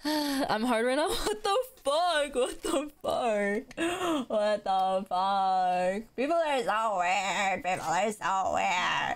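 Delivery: very slow, stretched-out words; drawn-out disbelief. Pretend. Streamer reacting to weird people, slow drawn-out disbelief. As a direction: drag every word out in disbelief. Mock reaction to crude comments.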